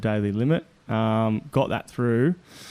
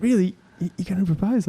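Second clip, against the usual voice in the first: higher-pitched, incredulous questions; retold surprise; 7 semitones higher, faster than usual. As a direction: imitate a friend taken aback by news. Friend reacts to proposal news.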